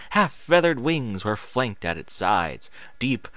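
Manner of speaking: ordinary delivery, nothing marked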